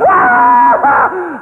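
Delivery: high, loud imitated child's cry; hammed-up child cry, high and loud; mock child wail. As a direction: yelp like a little girl hit with a belt. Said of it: "She goes".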